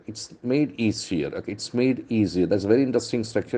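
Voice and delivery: ordinary talk, nothing marked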